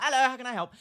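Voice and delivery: high, loud bright sales greeting; cheery shop greeting. Retold or imitated. Counter worker greeting a new customer, chirpy. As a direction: greet them like a keen shop assistant. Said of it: "beeline for them and be like".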